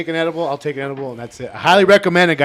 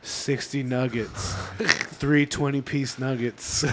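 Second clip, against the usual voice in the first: slow stoned order; 4 semitones lower, 9 dB quieter, slower than usual. Retold or imitated. Slow stoned drive-thru order.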